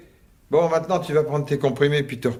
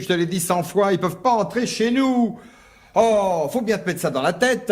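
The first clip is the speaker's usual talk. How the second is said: raising his voice; 7 semitones higher than usual. Acted. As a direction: insist firmly as the voice climbs. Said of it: "ne crie pas, Michel". (hausse un peu le ton, agacé)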